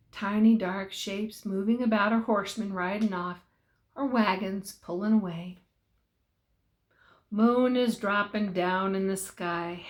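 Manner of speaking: plain reading voice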